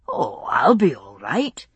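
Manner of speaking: slow, breezy confidence; casual bravado. A narrator's reading. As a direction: brush off her worry lightly. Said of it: "with casual bravado".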